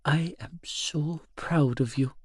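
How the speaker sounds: slow, breathy half-whisper; soft, drawn-out old woman's whisper; whispered pride. A narrator's reading. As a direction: press it on him tenderly. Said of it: "she pushed".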